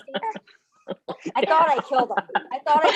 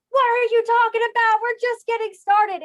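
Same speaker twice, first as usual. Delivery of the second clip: loud, squealed mock disbelief; high-pitched protest; 13 semitones higher, 7 dB louder than usual. Partier to friend going to bed early, high loud protest.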